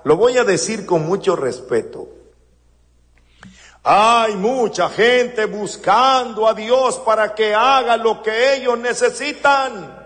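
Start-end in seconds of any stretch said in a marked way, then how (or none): none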